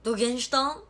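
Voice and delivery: loud, punchy Hakata dialect; forceful demo of heavy Hakata dialect; thick Hakata dialect. Shown as a demonstration. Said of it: "どぎつい博多弁喋って".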